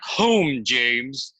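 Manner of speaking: lightly imitated grand order to a chauffeur; Big Daddy's deep voice. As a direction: play the boss calling to the driver. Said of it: "in that deep voice of his".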